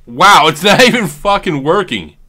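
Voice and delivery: high, loud exasperated outburst; loud, high burst of annoyance. Real, not acted. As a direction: vent real frustration, loud and high. Reader tool fails while recording.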